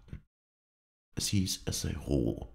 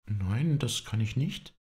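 higher, puzzled protest; confused; 8 semitones higher, slower than usual. Acted. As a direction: say it bewildered, pitch lifted. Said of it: "erwiderte sie verwirrt". (verwirrt, höher gesprochen)

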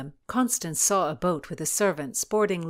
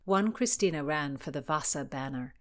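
ordinary delivery, nothing marked

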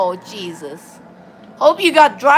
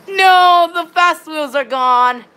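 dismayed cry; 4 semitones higher, slower than usual. Pretend. Gamer to viewers as the fast wheels are gone, dismayed cry.

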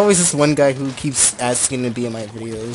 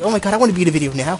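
eager fan; 6 semitones higher, 4 dB louder, much faster than usual. Retold or imitated. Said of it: "people are like".

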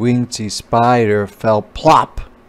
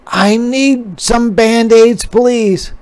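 higher, louder, whiny ask; hurt little spider; 12 semitones higher, 6 dB louder, slower than usual. A narrator's reading. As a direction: ask mama for help. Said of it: "cried the spider".

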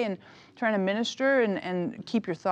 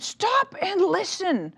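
high, loud, slow and insistent; acted-out kid's drawn-out yell; retold child's yell; 11 semitones higher, 5 dB louder, much slower than usual. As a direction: imitate a small boy shouting an order. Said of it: "he yells and he says".

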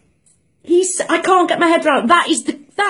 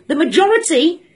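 ordinary talk, nothing marked

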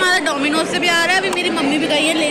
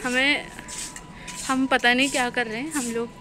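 low, much softer sneaky vlogging voice; hushed secretive; 5 semitones lower, 10 dB quieter than usual. Real, not acted. Friends sneaking photos in a clothing store, hushed and conspiratorial. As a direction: keep it down, as if sneaking.

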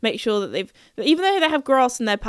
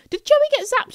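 imitated viewer; 14 semitones higher, 3 dB louder than usual. Voicing a viewer's question.

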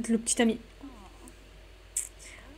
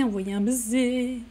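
ordinary talk

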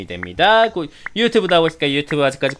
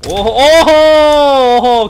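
excited whoop; 7 semitones higher, 9 dB louder, much slower than usual. Real, not acted.

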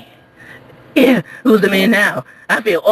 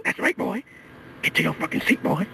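whispered threat, much softer; menacing whisper; 11 dB quieter than usual. Acted. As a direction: hiss the threat quietly. Threat after shouting.

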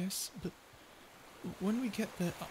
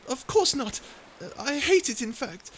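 loud denial; 2 semitones higher, 7 dB louder than usual. Acted. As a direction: protest too much. Denies liking listener's name.

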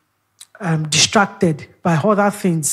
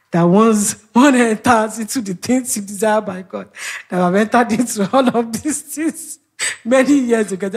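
joking tone; 4 semitones higher, 3 dB louder than usual. Retold or imitated.

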